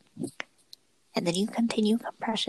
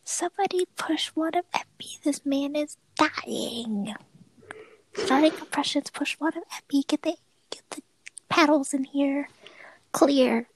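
frantic TV doctor shouting orders; hammed-up medical drama callouts; trauma bay callouts; 5 semitones higher than usual. Acting a TV hospital scene.

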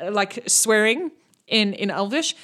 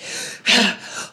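gasp. Acted. NPC gasps.

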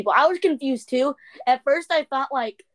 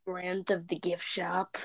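low, slow British introduction; put-on British voice, lower and softer; mock British accent; 4 semitones lower, 8 dB quieter than usual. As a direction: introduce the character slowly in fake British. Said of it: "Do a British accent".

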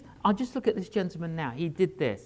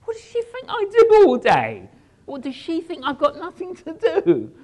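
much higher and louder mock outrage; exasperated outburst; 9 semitones higher, 9 dB louder than usual. Minister ranting about Barbara's request, mock outraged shout. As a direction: let your wounded pride boil over, hammed up. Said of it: "now my humanity kicks in".

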